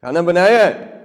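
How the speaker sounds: brusque husband pressing for an answer; demanding tone. Shown as a demonstration. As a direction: fire the question like an accusation. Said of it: "Tone है ना?".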